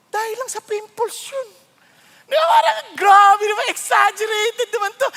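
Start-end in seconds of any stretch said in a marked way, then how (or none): 2.5-5.1 mock exasperation. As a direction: call out the overacting. Reacting to exaggeration.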